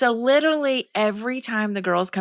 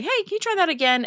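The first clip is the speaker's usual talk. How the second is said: mom correcting; 10 semitones higher, faster than usual. Retold or imitated. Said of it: "I would say".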